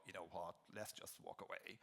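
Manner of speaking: low, soft, defeated murmur; soft fed-up sigh of giving up. Retold or imitated. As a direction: drop the voice, worn down and ready to quit. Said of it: "we go".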